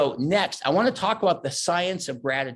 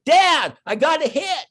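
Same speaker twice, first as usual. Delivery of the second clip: son yelling across the field, much higher and louder; calling from far; 13 semitones higher, 8 dB louder, slower than usual. Retold or imitated. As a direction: shout it from second base to dad in the stands. Son shouting from second base.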